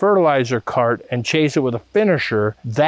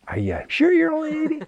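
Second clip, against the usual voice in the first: much higher, fast mock amazed voice; teasing disbelief; faster than usual. Teasing old brothers about their age in a high amazed voice. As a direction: squeak out playful disbelief.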